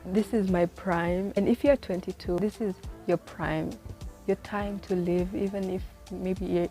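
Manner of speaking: ordinary talk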